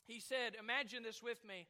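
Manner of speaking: ordinary delivery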